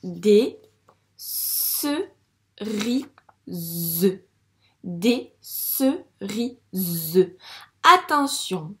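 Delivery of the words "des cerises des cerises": "cerises" very slow, as sse, ri, zzeu; syllable by syllable. Shown as a demonstration. Teacher correcting reading, "cerises" sounded out slowly. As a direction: separate "cerises" into three pieces, buzzing the final z. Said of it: "ce S fait le son Z".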